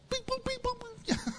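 tiny high, soft beeping; imitated beeps. Preacher dismissing a garbage idea, imitated high soft beeps. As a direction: beep like a little machine.

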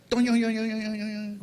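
goofy boing-like yoing sound effect; springy hand noise. Retold or imitated. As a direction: make a silly springing noise with your voice. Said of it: "whatever noise a new hand makes".

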